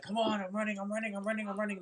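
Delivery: high, breathless-style running chant; frantic self-urging. Retold or imitated. Dreamer fleeing the fire, high frantic self-urging. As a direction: rattle it off high, urging yourself on.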